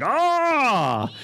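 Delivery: sudden short high squeal of fright; high-pitched squeal. Shown as a demonstration. Pastor demos his own fright squeal. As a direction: squeal like a startled man. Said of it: "one of those high-pitched squeals".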